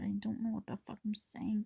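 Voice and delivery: hushed muttered aside; whispering. Real, not acted. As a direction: whisper it under your breath.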